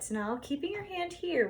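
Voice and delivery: normal talking voice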